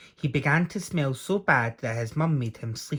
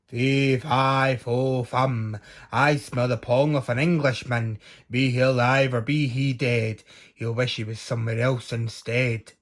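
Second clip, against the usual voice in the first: loud booming giant chant; roaring giant; 3 dB louder than usual. Acted. Giant roaring to sniff out Jack, booming chant. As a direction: boom out the rhyme like a huge giant. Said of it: "roared the giant".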